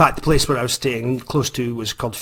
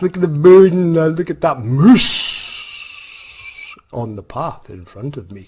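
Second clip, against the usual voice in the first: enthused by nature; 3 semitones higher, 4 dB louder than usual. Retold or imitated. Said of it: "I was like".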